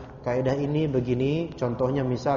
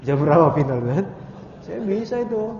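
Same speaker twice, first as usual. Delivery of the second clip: excited shout; 5 semitones higher, 5 dB louder than usual. Pretend. Excited imagined football trip.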